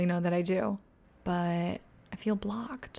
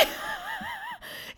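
shrieking laugh; 9 dB quieter than usual. Real, not acted. Vlogger shrieking at a dancing man in the street. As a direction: burst out in a high squealing laugh.

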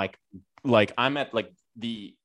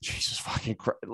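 whispered curse; 6 dB quieter than usual. Retold or imitated. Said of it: "and I'm like".

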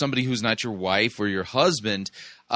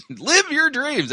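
mocking. Mocking the show's slogan.

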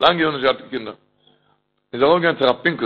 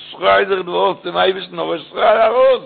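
slow, stretched urging that climbs higher; urgent exhortation; 5 semitones higher, slower than usual. Retold or imitated. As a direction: build steadily to a high, drawn-out command. Urging the man to scream out. (דרינגענד צורעדן, אלץ העכער)

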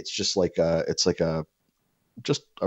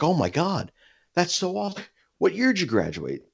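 put-on eager neighbor kid; 13 semitones higher than usual.

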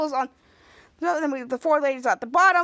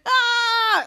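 high, loud mock yell; mock battle yell; 8 semitones higher, 5 dB louder than usual. Ray yells as he goes to smash the vase, high and loud.

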